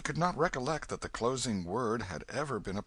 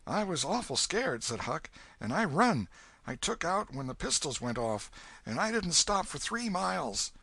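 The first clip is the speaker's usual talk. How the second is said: put-on scared boy; 7 semitones higher than usual. Huck telling his fright.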